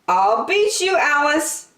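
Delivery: high, loud gleeful shout. Acted. Angelina racing Alice downhill, shouting high and loud. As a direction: shout the challenge mid-race. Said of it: "Angelina shouted".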